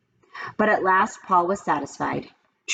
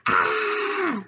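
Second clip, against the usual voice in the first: high shrill scream; Leslie's sudden high scream. A narrator's reading. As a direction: let out a high girlish scream. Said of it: "Leslie screamed".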